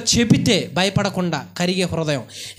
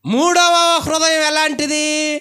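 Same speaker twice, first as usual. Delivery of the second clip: slow, stretched higher question; drawn-out question; 4 semitones higher, 3 dB louder, much slower than usual. Real, not acted. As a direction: draw the question out to the crowd. Preacher asks the congregation. (నెమ్మదిగా సాగదీసిన ప్రశ్న)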